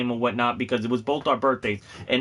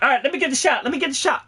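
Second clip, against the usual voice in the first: high excited Prompto impression; 13 semitones higher, much faster than usual. Actor playing Prompto while setting up the photo, high and eager. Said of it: "in Prompto's voice".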